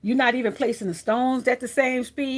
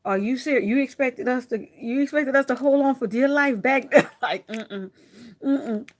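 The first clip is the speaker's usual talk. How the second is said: sassy bling voice; 4 dB louder than usual. Pretend. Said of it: "Bling was up there like".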